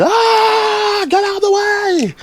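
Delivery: high, very loud yell to clear the path; full-volume shout in place of a bike bell; shouted warning. Shown as a demonstration. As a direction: scream at people to move aside. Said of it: "can I recommend shouting".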